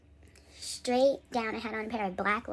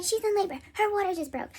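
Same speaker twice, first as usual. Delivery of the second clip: raised, higher voice imitating mom; urgent mom; 8 semitones higher, 4 dB louder than usual. Mom urgently telling hospital staff.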